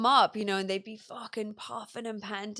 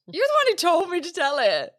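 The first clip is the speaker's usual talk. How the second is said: laughing protest; 9 semitones higher, 10 dB louder than usual. Real, not acted.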